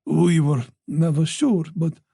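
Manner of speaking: plain reading voice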